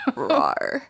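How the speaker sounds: playful roar; little mock roar; dinosaur voice. Imitates lion on label.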